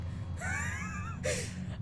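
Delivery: squealing high-pitched laugh; high laughing fit. Real, not acted. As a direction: burst out in helpless giggles. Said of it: "I can't keep a straight face".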